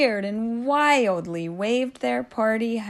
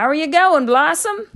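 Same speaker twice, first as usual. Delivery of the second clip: higher, louder bright call; cheery mother; 6 semitones higher, 7 dB louder than usual. A narrator's reading. Mother calling up to her training daughter, cheery and high.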